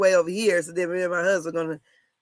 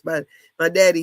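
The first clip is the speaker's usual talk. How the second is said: ordinary delivery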